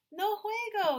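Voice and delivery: put-on high, loud little-boy refusal; toddler voice. A narrator's reading. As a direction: say it like a tiny child who won't play.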